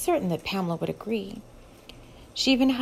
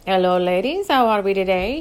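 put-on a light, polished Texas accent; smooth Texas waiter welcome, lightly voiced; professional Texas accent; 6 dB louder than usual. A narrator's reading. Waiter greeting two ladies, light professional Texas accent. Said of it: "in his most professional Texas accent".

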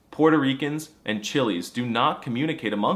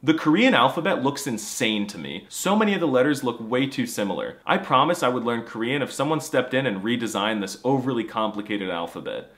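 ordinary talk

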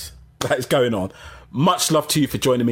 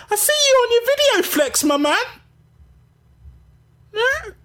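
much higher excited praise; excited hype. Real, not acted. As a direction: gush over a friend. Hyping up viewer.

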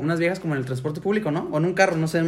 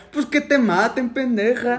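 put-on Yucatecan accent, higher and sing-song; teasing a Yucatecan accent with a higher voice; 6 semitones higher, slower than usual. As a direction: mimic the girlfriend's strong Yucatecan accent. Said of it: "el acento sí está muy".

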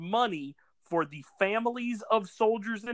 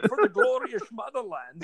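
mock Connery as Russian; 4 semitones higher than usual. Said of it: "he's like".

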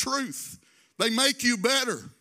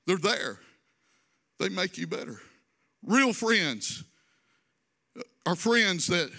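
ordinary talk

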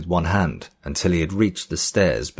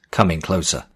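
usual speaking voice

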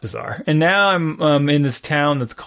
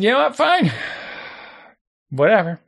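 exasperated giving up; 8 semitones higher than usual. Retold or imitated. Giving up, exasperated self-quote.

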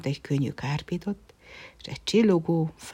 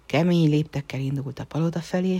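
ordinary delivery, nothing marked

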